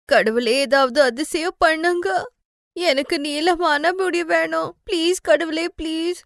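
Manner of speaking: put-on girl praying at night for long hair; tearful prayer. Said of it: "கடவுள்கிட்ட வேண்டுவா".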